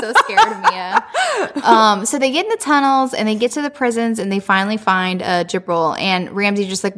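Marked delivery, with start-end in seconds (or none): 0.0-1.0 laughing. Real, not acted.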